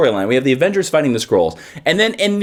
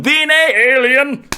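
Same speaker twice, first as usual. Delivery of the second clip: high, grand mock Old English boom; Thor voice; 9 semitones higher, slower than usual. Thor bellowing at aliens in space, mock grand cry. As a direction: ham up a lofty Asgardian cry. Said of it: "Thor going".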